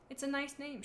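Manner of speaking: soft storybook murmur; quiet, understated character line. A narrator's reading. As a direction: say it gently, under the voice. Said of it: "she murmured".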